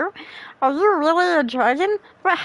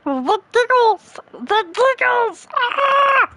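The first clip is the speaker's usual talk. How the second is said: higher, slow wailing cries; hammed-up lament; mock despairing shout; 10 semitones higher, slower than usual. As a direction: howl it in playful despair.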